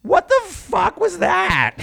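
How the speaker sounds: high outraged shriek, drawn out; shrieking queen. Retold or imitated. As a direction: screech it like an outraged drama queen. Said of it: "I'm like".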